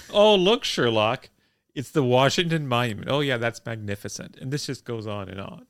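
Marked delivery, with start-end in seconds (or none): 0.1-4.4 eager tour guide. Retold or imitated. Driver showing Sherlock the monuments, mock gushing. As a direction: point out each sight with forced delight. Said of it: "the driver will say".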